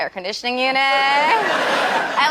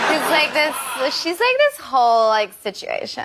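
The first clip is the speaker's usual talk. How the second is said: put-on Valley girl accent. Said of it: "Callie from the Valley".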